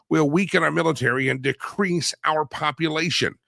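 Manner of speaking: ordinary talk, nothing marked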